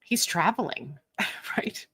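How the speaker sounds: hushed sports guess, half laughing; breathy hush of a pretend expert; whispered fake commentary. Shown as a demonstration. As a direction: whisper it like you know the game. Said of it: "I just say stuff".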